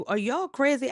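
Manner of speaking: high, loud, furious snap; angry outburst. Retold or imitated. Shango yelling down from the tree at the searchers, furious. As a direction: blow up at them, high and loud. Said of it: "Shango was so angry".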